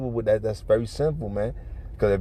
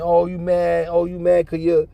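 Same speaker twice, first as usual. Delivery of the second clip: higher, needling imitation of a taunt; mock taunt; 7 semitones higher, 4 dB louder than usual. Woman goading her man in an argument, mock taunting.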